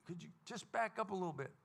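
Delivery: polite. Retold or imitated. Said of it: "I'm still pretty nice".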